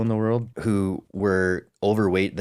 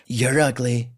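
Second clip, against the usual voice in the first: Trump insult; 3 semitones higher than usual. Retold or imitated. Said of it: "It's always been his approach".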